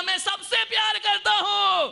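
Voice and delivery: high, loud joyful shout; child shouting. Retold or imitated. Child shouts his love to the mountains. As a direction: fling it out happily. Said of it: "उसने कहा".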